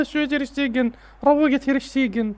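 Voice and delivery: high-pitched incredulous scolding; alarmed pleading. Real, not acted. Fan telling his keeper to relax, high alarmed pleading.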